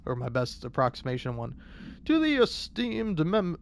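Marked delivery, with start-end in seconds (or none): 2.0-3.6 put-on British accent. Starting a listener letter in a light British accent. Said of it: "I'm just doing my British voice".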